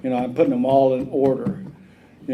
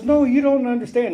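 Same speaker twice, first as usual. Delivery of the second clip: high pleading protest in her voice; high, louder mock protest; protesting girlfriend; 12 semitones higher, 5 dB louder than usual. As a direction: plead like the thrown-out woman.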